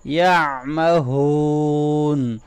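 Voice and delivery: یعمہون with the ain from mid-throat and a heavy ha; throaty ain, heavy ha. Shown as a demonstration. Teacher demonstrating throat letters to students. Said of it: "عین ساکن کو ... گلے کے middle سے".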